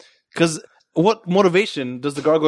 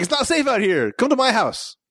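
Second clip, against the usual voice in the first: urgent persuasion; 8 semitones higher than usual. Acted. Acting man luring the woman.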